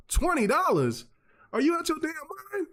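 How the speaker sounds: high, fast shocked grandma squawk; outraged grandma. Retold or imitated. Grandma to grandson over a pricey toy, outraged high shriek.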